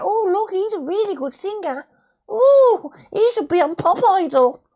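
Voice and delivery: very high, loud gushing mock voice; mock gushing fan. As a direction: swoon like a starstruck fan. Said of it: "Karaoke's not meant to be about".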